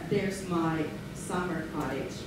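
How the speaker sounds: ordinary talk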